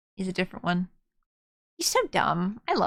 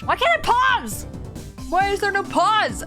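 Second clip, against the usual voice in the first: frustrated; 8 semitones higher than usual. Real, not acted. Frustrated outburst at game.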